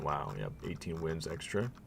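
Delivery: usual speaking voice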